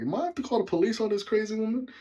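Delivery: driver's nervous thought; worried inner thought. Retold or imitated. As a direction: rush out the worry. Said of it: "just thinking in my head".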